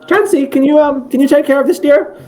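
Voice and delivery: high-pitched falsetto nurse voice; Nurse Joy. Acted. Nurse asks her helper to take the parcel, high put-on voice. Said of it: "And she'll, and she'll go".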